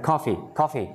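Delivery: COF-fee, front-stressed; stress on first syllable. Shown as a demonstration. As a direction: lift the start of "coffee". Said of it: "Nhớ đầu lên".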